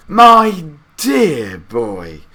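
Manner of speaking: put-on slow, loud, high old man's voice; Grandpa Joe. A narrator's reading. Grandpa Joe answering his grandson, slow fond old man's voice. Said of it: "said Grandpa Joe".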